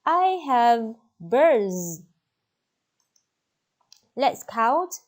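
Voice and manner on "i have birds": slow, loud and clear; slow modelling voice. Shown as a demonstration. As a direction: spell it out for a child. Teacher modelling answer.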